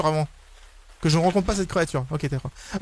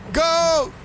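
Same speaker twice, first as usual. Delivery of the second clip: urgent high shouts; 11 semitones higher, much slower than usual. Real, not acted. Gamer urging the truck away from the monster, high shouts. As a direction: yell to escape. Escaping in pick-up.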